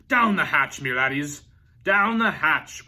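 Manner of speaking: loud pirate bellow, slow on the last; yelling toast. A narrator's reading. Braidbeard yells at the dinner table, loud bellow. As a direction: roar it, fist on the table. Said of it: "pounded his fist on the table and yelled".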